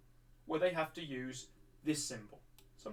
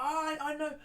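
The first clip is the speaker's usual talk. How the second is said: put-on high, loud eager kid; eager blurt; 10 semitones higher, 5 dB louder than usual. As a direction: burst out like a child waving a hand. Eager self waving hand.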